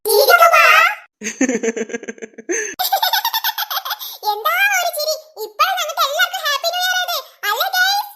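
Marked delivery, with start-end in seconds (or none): 0.0-0.6 loud group demand; urging. Acted. Children urge grandpa to laugh. 1.2-4.3 long Santa-like chuckle; laugh on request. Acted. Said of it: "അപ്പൂപ്പനൊന്ന് ചിരിച്ചേ".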